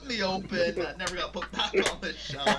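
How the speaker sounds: laughing tease. Real, not acted. Teasing GM, laughing.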